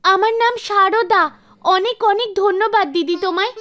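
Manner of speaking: put-on high, grateful child voice; a small child saying thanks; little girl. Child introduces herself gratefully.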